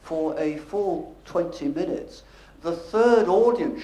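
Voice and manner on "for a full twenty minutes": slowly, each word dragged out; drawn-out words to show boredom; slow-speech demo. Shown as a demonstration. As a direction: crawl through it to show a dull speaker. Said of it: "talks as slowly as this".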